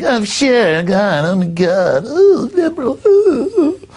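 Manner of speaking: very high squealing put-on voice; mock trendy swooning. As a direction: gush like a swooning trendy, pitch soaring. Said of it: "The trendies will be like".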